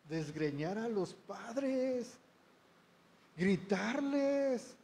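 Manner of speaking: voz aguda y alargada, sin subir el volumen; grito imitado. Retold or imitated. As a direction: estirar las palabras subiendo el tono. Mimics children wanting to yell at parents. (grito fingido y alargado)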